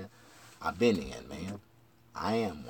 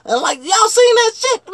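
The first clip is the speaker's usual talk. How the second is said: incredulous shout; 12 dB louder than usual. Real, not acted. Incredulous at fake fight.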